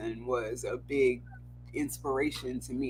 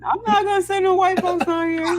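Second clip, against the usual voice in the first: real laughing, high and loud; laughing protest; 9 dB louder than usual. Real, not acted. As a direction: protest loudly through the laughter. Teased, protests while laughing.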